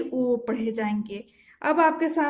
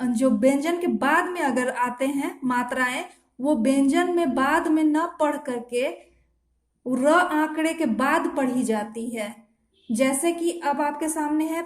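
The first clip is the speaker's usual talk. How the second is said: normal talking voice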